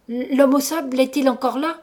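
narrated frightened stammer. A narrator's reading. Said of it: "dis-je en bégayant".

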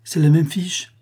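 high, loud worried question; anxious exclamation. A narrator's reading. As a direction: cry it out with a touch of anxiety. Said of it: "Je m'écriai avec anxiété".

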